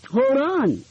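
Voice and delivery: calling out. A narrator's reading. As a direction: call out to stop her. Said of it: "her father called".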